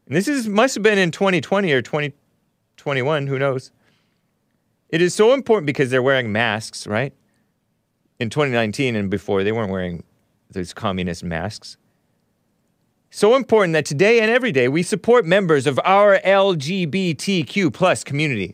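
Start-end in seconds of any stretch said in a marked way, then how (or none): 4.9-5.6 high, sing-song mock sincerity; mocking tweet read. Host mocks the mayor's tweet in a sing-song voice. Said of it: "pictures that she tweeted out". 13.1-18.5 higher, syrupy mock-earnest reading; mocking tweet read. Host parodies the mayor's pride tweet, mock sweet. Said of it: "pictures that she tweeted out".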